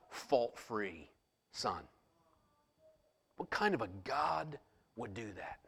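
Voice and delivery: soft intimate preaching close to a whisper; hushed preaching. Acted. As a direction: draw them in with a hush. Quiet intense climax of sermon.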